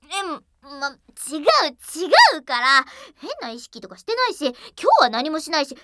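loud, quick, flustered protest; flustered denial. Acted. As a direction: deny it in a panic.

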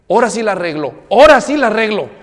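en voz baja y luego más fuerte, imitando al esposo; marido amenazante. Retold or imitated. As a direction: remata el chiste con la amenaza del marido. Esposo amenaza al abrir puerta. (el esposo imitado, creciendo en volumen)

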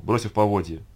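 ordinary delivery, nothing marked

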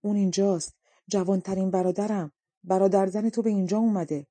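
plain reading voice

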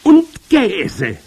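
very high, loud witch cry; forceful high witch call. A narrator's reading. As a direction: cry it out triumphantly to the hall. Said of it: "rief die Hoch".

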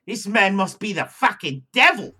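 mock Australian accent, loud; hammed-up Australian bloke. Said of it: "my best Australian accent".

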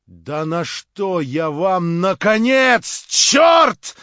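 rising to a high, loud furious shout; slow start building to an angry yell; angry outburst. A narrator's reading. Stavrogin shouting at Verkhovensky in anger and astonishment. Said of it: "вскричал в решительном гневе".

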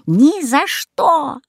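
high, slow witch screech; witch shrieking. A narrator's reading. Baba Yaga screeching at the goose, refusing to free the kids. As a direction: screech your refusal like a hag.

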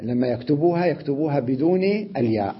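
normal talking voice

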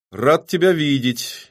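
mock-welcoming, drawn out slowly; snide. A narrator's reading. Killer greets the rival who finally came, snidely. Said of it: "ехидно добавил".

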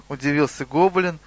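ordinary delivery

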